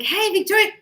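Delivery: high, lively imitation of greeting a daughter; mother's cheery call. Mother cheerily calling moody daughter.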